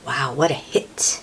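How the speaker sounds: ordinary delivery, nothing marked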